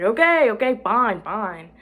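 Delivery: loud, high mock surrender; exasperated giving in. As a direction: give in loudly to a nagging friend. Retelling giving in to friend's nagging.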